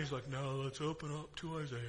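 low, quiet drone of a dull preacher; sleepy preacher. Retold or imitated. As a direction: imitate a preacher putting everyone to sleep. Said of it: "a little bit of a sleeper".

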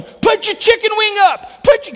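high, loud coaching yell. Retold or imitated. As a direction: bark it like a Little League coach. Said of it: "I'm back there going".

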